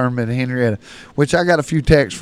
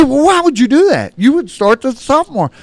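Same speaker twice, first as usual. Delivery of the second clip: indignant parent; 11 semitones higher, 6 dB louder than usual. Retold or imitated.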